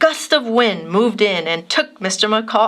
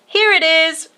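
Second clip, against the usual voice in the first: mild shout; 8 semitones higher than usual. A narrator's reading. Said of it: "shouted Maxton".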